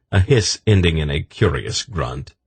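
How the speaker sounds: usual speaking voice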